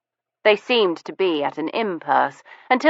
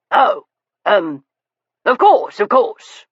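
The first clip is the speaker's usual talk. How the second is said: flustered gentleman; 4 semitones higher, 4 dB louder than usual. A narrator's reading. Sir Leicester flustered reply.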